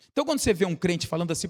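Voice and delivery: ordinary delivery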